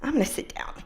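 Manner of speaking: soft breathy whisper of giving up; whispered to herself. Retold or imitated. Woman in the mud whispering to herself as she sits. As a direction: whisper it to yourself, resigned. Self talk, sitting in mud.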